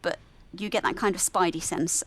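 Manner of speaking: normal talking voice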